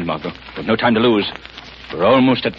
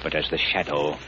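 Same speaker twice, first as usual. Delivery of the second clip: low, hushed, secretive and menacing; the hidden avenger speaking softly in the dark; the Shadow's hushed voice; 8 semitones lower, 7 dB quieter than usual. Acted. Lamont becomes the Shadow, hushed.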